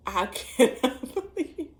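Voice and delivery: slow, high words broken by nervous laughter; uncomfortable laughing. Real, not acted. Girlfriend recalling her cracked windshield, uncomfortable laughing. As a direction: laugh uneasily through the words. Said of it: "I laugh just like I'm doing right now".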